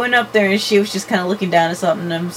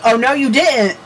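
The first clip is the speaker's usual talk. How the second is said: sassy; 4 semitones higher, 4 dB louder than usual. Retold or imitated. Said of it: "I'm all like".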